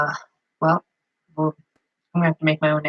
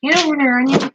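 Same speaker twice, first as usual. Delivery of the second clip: playful higher voice, shooing the onion along; mock scolding the onion; 8 semitones higher, 3 dB louder, faster than usual. Cook playfully shooing an onion on the cutting board, mock scolding. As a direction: tell the onion off, half joking.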